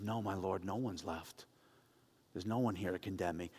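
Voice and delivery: a frightened woman's quiet relief; humble soft reply. Acted. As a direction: answer him meekly, barely above a hush. Woman answers Jesus after accusers leave.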